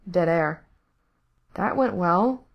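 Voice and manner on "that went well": ordinary delivery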